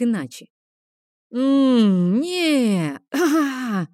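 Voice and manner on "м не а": long, higher-pitched wordless moans; inarticulate moaning. A narrator's reading. Spider losing his speech tries to insult, inarticulate groaning. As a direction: struggle to force words out, only drawn-out noises come. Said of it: "выдавал из себя нечленораздельные звуки".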